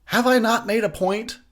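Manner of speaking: a hammed-up fed-up Jason scolding; annoyed Jason. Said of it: "that's his attitude".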